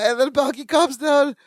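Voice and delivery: ordinary delivery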